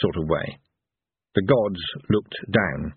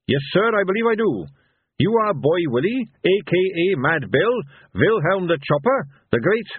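higher, dutiful officer's voice; Captain Carrot; 9 semitones higher than usual. A narrator's reading. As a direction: recite the names politely, pitched up. Carrot identifies Boy Willie.

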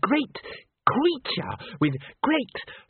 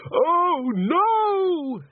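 high, louder wail of horror; drawn-out shrieked cry; scream; 4 dB louder than usual. A narrator's reading. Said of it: "screamed someone".

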